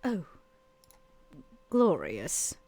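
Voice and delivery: ordinary delivery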